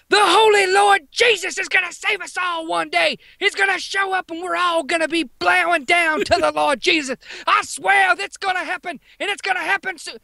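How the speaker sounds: high, strained mock preacher holler; imitated screaming. Pentecostal kid to all of Taco Bell, high screaming rant. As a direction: belt it out like a crazed kid evangelist. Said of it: "starts screaming in fucking Taco Bell".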